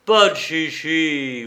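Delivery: drawn-out growled warning; slow, threatening growl. A narrator's reading. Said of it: "gronda Weber".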